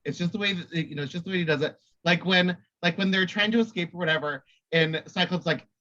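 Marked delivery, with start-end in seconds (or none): none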